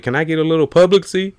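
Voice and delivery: high, fast put-on voice; mock man asking. Man bugging a breastfeeding woman, fast high mock voice. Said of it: "He was like".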